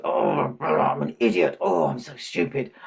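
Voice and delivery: loud, exasperated groaning at oneself; mock wail of frustration; self-reproach. Washman overheard scolding himself, loudly exasperated.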